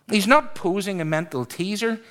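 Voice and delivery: normal talking voice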